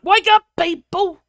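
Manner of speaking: loud, slow, high call to wake people up; rallying shout. Real, not acted. Vlogger urging viewers to rise up, loud slow shout. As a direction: bellow it like rousing a crowd. Ranting at British public.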